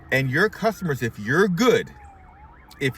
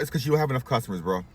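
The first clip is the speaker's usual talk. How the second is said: ordinary talk, nothing marked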